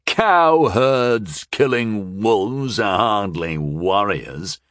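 slow, drawn-out scoff; lordly scorn, stretched out. A narrator's reading. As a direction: scoff it slowly, falling away at the end. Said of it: "scoffed another West Flarian lord".

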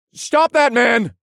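loud slow shout; calling out. Acted. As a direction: yell it across the street. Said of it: "I called out".

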